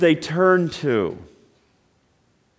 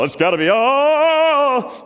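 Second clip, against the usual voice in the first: a playful macho yell; mock tough-guy holler; mock manly yell; 11 semitones higher, 3 dB louder, slower than usual. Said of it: "when we scream or yell".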